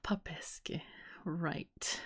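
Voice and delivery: very soft, near-whispered musing; barely voiced, thinking aloud; murmuring to oneself. Real, not acted. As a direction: try the word out under your breath. Mulls the word over to himself.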